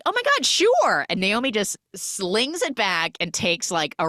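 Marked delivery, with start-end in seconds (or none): 0.1-1.1 eager. Retold or imitated. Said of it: "she's like".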